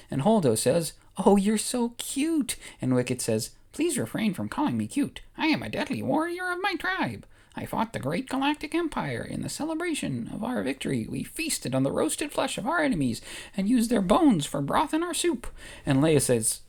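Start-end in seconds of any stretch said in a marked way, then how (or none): 1.2-2.6 cooing Holdo. Acted. Holdo coos at Wicket. 3.7-15.5 high, proud little Ewok voice; Ewok warrior voice. Acted. Ewok telling Holdo not to call him cute, high and proud. Wicket voiced in told story.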